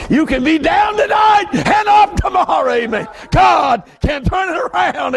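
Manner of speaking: high shouted preaching; preaching at full force. Real, not acted. Preacher shouting God's power to the church. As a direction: ring it out to the pews.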